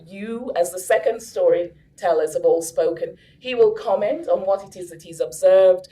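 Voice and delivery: ordinary talk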